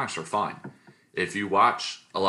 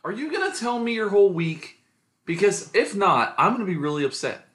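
playful whine, pitched much higher; mock upset; 11 semitones higher, 3 dB louder than usual. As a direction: tease him as if truly hurt. Teasing co-host mock complaint.

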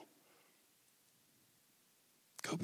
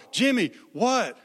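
higher, louder worried call; urgent calling; 8 semitones higher, 9 dB louder than usual. Retold or imitated. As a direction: call out to the boy in alarm. Friends calling to crying boy.

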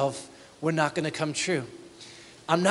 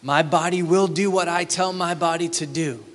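arrogant boast; 4 dB louder than usual. Retold or imitated. Pastor mocks a merchant's pride, loud swagger. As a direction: puff up and declare it. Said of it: "Do you feel it?".

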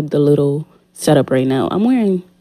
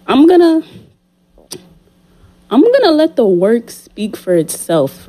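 hyped up; 7 semitones higher than usual. Real, not acted. Hyped boast about leveling up.

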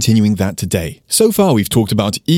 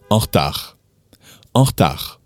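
quick casual. Shown as a demonstration. Teacher demonstrating the fast street version. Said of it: "that would sound like this".

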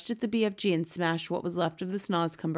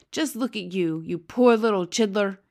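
dismayed cry, growing louder; pitying cry; 2 semitones higher, 7 dB louder than usual. A narrator's reading. B F G sees messy Sophie, crying out. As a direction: exclaim in pity, swelling on the end. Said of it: "cried the B F G".

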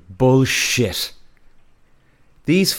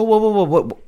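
higher-pitched mock alarm, words tumbling; startled protest; 7 semitones higher than usual. Woman pulling back from her date's kiss, startled protest. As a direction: imitate someone startled into backing off.

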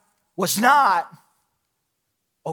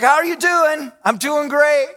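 bright, higher mock small-talk; fake cheerful greeting; 7 semitones higher, 4 dB louder than usual. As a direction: chirp it like a hollow pleasantry. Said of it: "how we lie when we greet people".

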